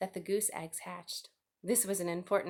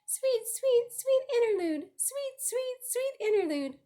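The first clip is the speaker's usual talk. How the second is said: very high sung bird song; birdsong; 5 dB louder, slower than usual. A narrator's reading. The song sparrow sings of brief lovely life, high birdsong.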